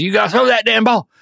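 mock an over-the-top football dad; angry West Texas dad. Imitating a Texan father chewing out his son in a hammed-up accent. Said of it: "gets real upset".

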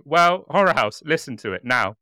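mock triumphant plug. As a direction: sell it like a victory shout. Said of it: "punchline re-enacted".

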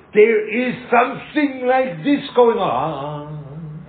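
slow, loud, drawn-out call into the ear; slow booming wake-up call; megaphone scream. Shown as a demonstration. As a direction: announce it like through a megaphone. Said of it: "takes a megaphone and screams in your ear".

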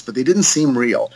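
ordinary delivery

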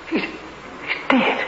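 hushed, horrified breath; shocked whisper. Acted. Doctor whispers over the dead man, shocked. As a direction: whisper it in disbelief.

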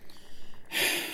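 long, quiet exhale; frustrated sigh. Acted. Boss exasperated with Daniels, heavy sigh. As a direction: breathe out heavily before speaking.

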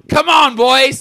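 high, loud rallying call; cox's shout. Shown as a demonstration. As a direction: shout the phrase to spur a crew. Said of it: "the way they would say it".

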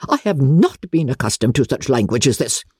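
very high, shocked indignation; scandalised aristocrat; affronted Lady Catherine. Acted. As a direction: pull rank in outrage.

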